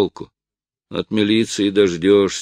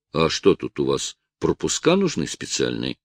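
ordinary delivery, nothing marked

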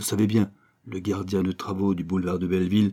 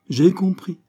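higher, louder interruption; cutting in; 9 semitones higher, 6 dB louder than usual. Acted. Toto interrupting the apache's rambling, loud cut-in. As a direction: cut him off sharply. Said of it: "interrompit Toto".

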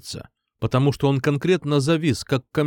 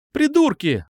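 put-on sharp girlish yell, high and loud; shout; 4 dB louder than usual. A narrator's reading. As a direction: yell it at the locked door. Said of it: "крикнула она".

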